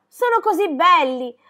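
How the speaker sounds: high, loud, breathless-with-joy urging; excited shouting. A narrator's reading. Girl urging her friend to come see flowers, excited shouting. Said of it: "le gridò già di lontano".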